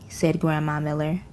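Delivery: plain reading voice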